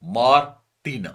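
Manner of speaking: مارٹینا slow and loud as maar, pause, tina; broken into chunks. Shown as a demonstration. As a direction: split مارٹینا into two emphatic chunks. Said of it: "میں نے اس کی chunk بنایا". (مارٹینا کو دو ٹکڑوں میں، زور سے)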